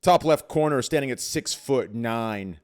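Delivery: loud ring announcer intro; hype intro. Pretend. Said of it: "that was a very nice intro".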